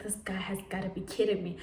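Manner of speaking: fast, breathy whisper to herself; whispered aside. Retold or imitated. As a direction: mutter it under your breath, fast. Private aside about the boyfriend.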